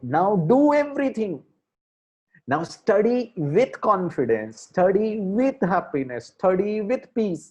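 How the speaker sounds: drawn-out motivational chant; slow preaching. Shown as a demonstration. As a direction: lean on each with like a sermon. Preaching study mantra.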